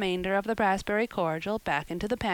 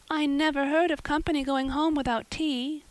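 high, mildly mournful plea; a girl mourning her spoiled tea party; mournful, high girl's voice; 8 semitones higher than usual. Acted. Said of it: "she mourned".

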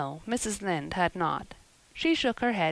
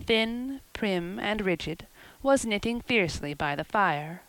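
usual speaking voice

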